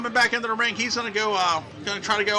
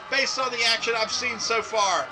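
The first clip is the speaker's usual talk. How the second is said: usual speaking voice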